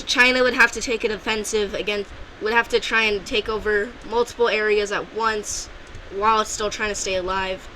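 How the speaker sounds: ordinary delivery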